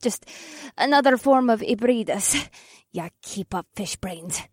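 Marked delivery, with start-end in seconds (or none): 2.9-4.4 low, soft scornful aside; huffed. A narrator's reading. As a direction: huff it under the breath. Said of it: "Akira huffed".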